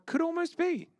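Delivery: high, slow silly voice. Pretend. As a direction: squeak it out slowly. High put-on voice.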